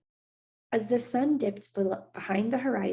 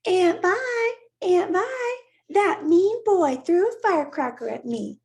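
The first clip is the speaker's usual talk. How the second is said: much higher, louder, whining tattletale voice; mocking whiny girl; 11 semitones higher, 8 dB louder than usual. A narrator's reading. Teasing Maya by imitating her tattling, mock whine. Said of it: "his voice rose in pretend mockery".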